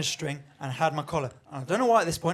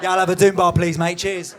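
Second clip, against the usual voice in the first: louder, matter-of-fact bloke at the bar; casual pub order; 8 dB louder than usual. Pretend. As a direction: order a pint as if nothing's odd. Gimp ordering at Wetherspoons.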